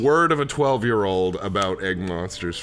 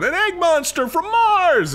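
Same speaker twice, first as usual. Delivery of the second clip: put-on very high, loud kid's cry; hammed-up child spreading alarm; yelling twelve-year-old; 6 dB louder than usual. Twelve-year-old tells the town, high mock yell. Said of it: "twelve-year-old going around going".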